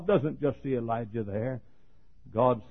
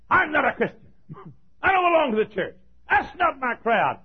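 Peter's denial; 14 semitones higher, 7 dB louder than usual. Acted. Peter denying Christ, acted.

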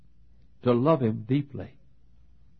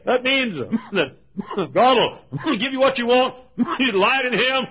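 high, loud mock with a laugh; mimicking what believers say, mocking; mocking church voice; 14 semitones higher, 7 dB louder than usual. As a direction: poke fun at easy theology. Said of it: "We say".

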